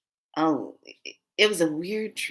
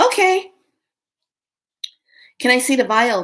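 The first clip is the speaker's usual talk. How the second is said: loud, high, emphatic retort; raised-voice retold demand; insistent demand; 6 semitones higher, 7 dB louder than usual. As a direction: call it out with forced politeness.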